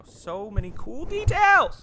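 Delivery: loud, high, drawn-out excited shout; excited yell. Real, not acted. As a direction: yell it, stretching out the last word. Gushing over the game's details.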